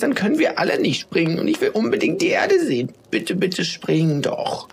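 very high, piping plea; desperate squeak. A narrator's reading. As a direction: beg like a tiny desperate snowflake, slowing at the end. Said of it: "Da piepste eine andere Schneeflocke".

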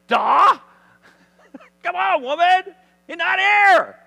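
high, loud incredulous shout; mock exasperation. Angels tell Mary the tomb is empty, loud exasperation. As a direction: shout it as if stating the obvious. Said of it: "They're like".

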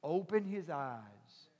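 soft, slow hushed prayer; quiet prayer. Retold or imitated. Elisha prays for his servant, soft and slow. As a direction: pray it gently, almost under breath.

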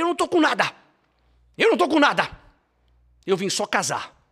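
high, loud desperate yelling; desperate shout. Retold or imitated. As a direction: scream it out like a terrified groom. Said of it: "Eu falei".

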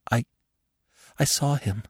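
high, halting, hushed stammer; frightened stammer. A narrator's reading. Marina trembling after seeing him, stammering in fear. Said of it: "she stammered".

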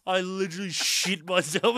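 slow it down and pitch it up, teasing; mimicking a friend. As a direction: tease by echoing him slowly. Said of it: "but the way you said".

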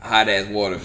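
ordinary talk, nothing marked

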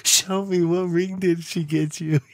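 mock camp voice. Dad teasing his buddy about the ring, campy gush. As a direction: gush over the ring to tease him.

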